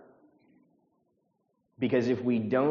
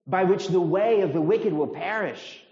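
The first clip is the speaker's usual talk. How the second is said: high, loud, emphatic disbelief; incredulous scorn; 8 semitones higher, 6 dB louder than usual. Acted. Preacher voicing the psalm's scorn to the congregation, incredulous. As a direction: drive it home as utterly obvious. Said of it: "It's saying, I mean".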